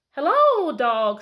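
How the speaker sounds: a bright hello from a proud duck; a duck calling out to the dog; cheerful duck greeting. Acted.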